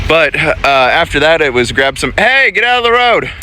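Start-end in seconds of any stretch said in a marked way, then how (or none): 2.2-3.3 shouting at the dog. Real, not acted. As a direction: snap her out of the road. Dog wandering into road.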